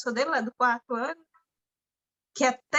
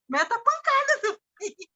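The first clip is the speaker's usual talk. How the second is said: mock tough mother; 8 semitones higher, faster than usual. Mother telling her son to hit back, high and emphatic. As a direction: egg the kid on to hit back. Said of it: "eu falei assim".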